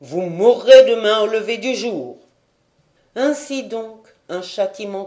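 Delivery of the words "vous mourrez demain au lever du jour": loud condemnation. A narrator's reading. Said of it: "s'écria Keikumu".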